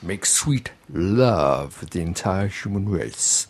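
low, slow seduction voice. Retold or imitated. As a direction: croon it like a soul loverman, half-deep.

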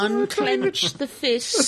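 slow, high, giggling coaxing; laughing mock soothing. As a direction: talk him down through laughter. Mock calming through laughter.

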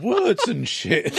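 slow laughing repeat. Real, not acted. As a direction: drawl it out through laughter.

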